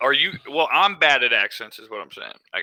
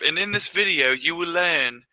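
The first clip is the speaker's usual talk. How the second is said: put-on putting on the co-host's British accent, pitched up; co-host's accent impression; 3 semitones higher than usual. Imitating co-host's accent for a video intro, British accent impression. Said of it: "is that your impression of my accent".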